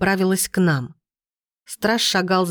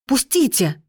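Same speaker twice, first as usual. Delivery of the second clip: understated, higher-pitched cry to be released; a restrained mock shout, voice close to breaking; breaking-voiced cry; 5 semitones higher, slower than usual. A narrator's reading. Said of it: "закричала срывающимся голосом". (сдержанный крик срывающимся голосом)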